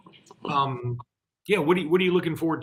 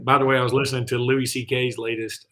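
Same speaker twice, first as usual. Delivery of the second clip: ordinary talk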